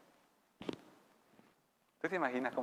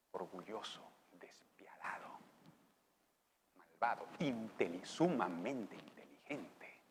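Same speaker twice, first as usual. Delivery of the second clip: hushed; 5 semitones lower, 9 dB quieter, slower than usual. Acted. Whispered list.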